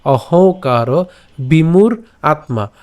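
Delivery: অহংকার বিমূঢ় আত্মা drawn out; slow careful pronunciation. Shown as a demonstration. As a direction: recite slowly. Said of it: "একসাথে উচ্চারণ করতে পারি".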